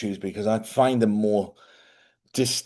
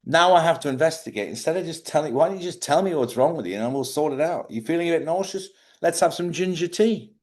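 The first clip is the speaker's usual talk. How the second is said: higher-pitched, put-upon complaint; mild exasperation; 7 semitones higher than usual. Acted. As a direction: plead for a straight answer. Acts out his irritation with wife.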